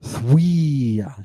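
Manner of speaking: "Thuia" as a long THWEEE-ah; digamma pronunciation. Shown as a demonstration. Teacher isolating the digamma, "Thuia" drawn out as THWEE-ah. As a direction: stretch the W glide and ee in "Thuia". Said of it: "Hear that digamma?".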